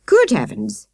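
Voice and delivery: startled exclamation; shocked at the scene; exclaimed. A narrator's reading. Sergeant seeing children on a stranger, startled. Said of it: "exclaimed Sergeant Patterson".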